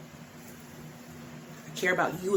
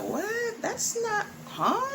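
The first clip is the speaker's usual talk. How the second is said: high confused squeak; high, puzzled mock double-take; mock baffled reaction; much slower than usual. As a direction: pitch it up like a student caught off guard. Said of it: "is gonna be like".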